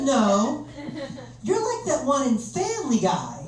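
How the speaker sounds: put-on high American girl voice; American broker. Broker correcting his Hugh Grant guess, in a mock American voice. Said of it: "She said".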